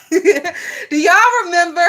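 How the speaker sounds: slow, giggling through the words; laughing. Real, not acted. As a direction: ask while cracking up. Laughing at her own bit.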